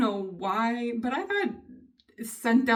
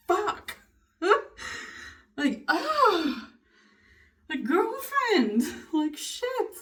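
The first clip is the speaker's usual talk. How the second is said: high, exasperated cursing with laughs; worked-up outburst; 8 semitones higher, much slower than usual. Real, not acted. Venting to the camera about constant jabs, worked-up. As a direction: let frustration burst out in high, drawn-out cries. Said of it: "Take it down a notch".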